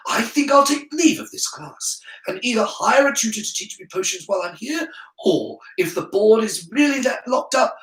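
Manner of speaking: put-on high-pitched, self-possessed boy; precocious pupil making a stand; defiant schoolboy. A narrator's reading. Voices Harry addressing the class.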